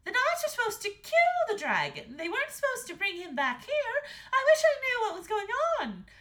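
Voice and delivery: very high, loud, worried queen; Queen Estelle. A narrator's reading. Queen fretting to the king about the knights, high and anxious. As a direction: fret loudly in a high queenly voice. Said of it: "said Queen Estelle".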